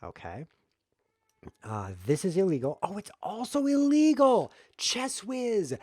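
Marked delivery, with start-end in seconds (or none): 2.8-5.8 high, loud exasperated cry; indignant high-pitched outburst; exasperated squeak. Real, not acted. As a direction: jump up high in disbelief. Exasperated at the game's rules.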